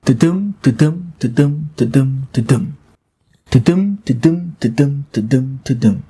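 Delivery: chanting ta-dum, ta-dum with the beat on dum; iambic ta-dum beat. Shown as a demonstration. Teacher showing students the pentameter beat, chanting rhythmically. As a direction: tap out the meter as a steady da-DUM. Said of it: "we are expecting to see the iambic pentameter".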